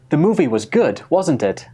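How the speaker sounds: "wasn't it" falls at the end; sure, confirming fall on "wasn't it"; falling tag question. Shown as a demonstration. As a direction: seek agreement with a drop on "wasn't it". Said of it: "I used a fall-in intonation".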